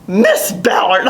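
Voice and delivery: slow, drawn-out mock contempt; imitated disdain. Upperclassman addresses the new Southern cadet by surname, imitated disdain. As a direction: imitate an upperclassman making the name a dirty word. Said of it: "say it like it's a dirty word".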